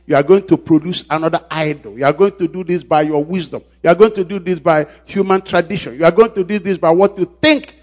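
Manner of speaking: climbing sermon refrain; building preacher cadence. Real, not acted. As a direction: build each line over the last.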